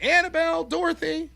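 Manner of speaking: high, sing-out call for pets; calling dogs. Acted.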